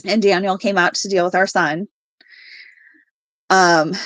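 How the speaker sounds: usual speaking voice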